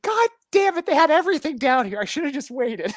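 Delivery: retold frustration, hammed up; mock frustrated cursing. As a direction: play your own annoyance big. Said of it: "I'm like".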